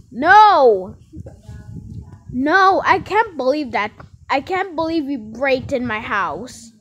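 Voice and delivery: angry outburst. Pretend. Parent confronting the kidnapper, acted angry shouting.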